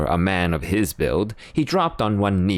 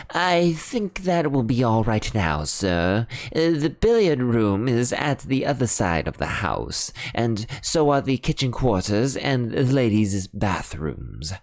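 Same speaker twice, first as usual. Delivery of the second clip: higher, discreet butler voice; reassuring butler; 5 semitones higher than usual. Acted. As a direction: butler assuring no one will hear. Parker reassures.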